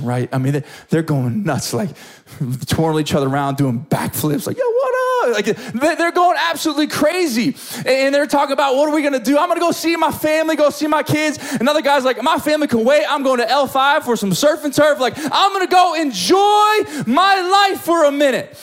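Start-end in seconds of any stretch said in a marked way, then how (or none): 4.6-5.3 high, whooping celebratory shout; wild celebration. Acted. Acts out healed men celebrating wildly. 8.8-11.4 high, rushing, elated chatter; excited planning. Acted. Acts out healed men's excited plans. 12.2-18.6 high, exuberant, building to a shout; jubilant. Acted. Acts out another jubilant healed man.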